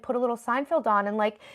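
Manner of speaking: usual speaking voice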